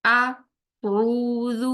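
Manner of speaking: اعوذ drawn out slowly, ع from mid-throat; tajweed recitation. Shown as a demonstration. Teacher demonstrating اعوذ with a mid-throat ع. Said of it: "ہمزہ ہے اور عین وسط حلق".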